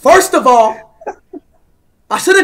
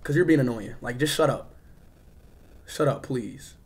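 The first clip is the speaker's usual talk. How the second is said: low, soft, deflated muttering; subdued; 13 semitones lower, 11 dB quieter than usual. Real, not acted. Streamer puzzling over being blocked, subdued. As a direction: keep it very low and quiet after the outburst. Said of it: "Let me calm down".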